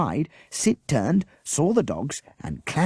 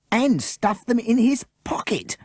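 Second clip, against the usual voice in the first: raised, eager shout; 13 semitones higher, 4 dB louder than usual. A narrator's reading. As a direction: call it out, jumping up. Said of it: "Morgan shouted".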